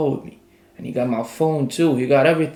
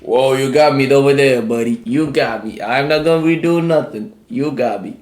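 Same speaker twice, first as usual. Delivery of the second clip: placating surrender; 2 semitones higher, 7 dB louder than usual. Retold or imitated. Facing a threat to shoot, he loudly gives in to the officer. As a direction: back off and appease him, giving in.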